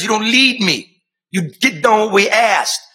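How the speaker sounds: mock rebuke. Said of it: "They represent us".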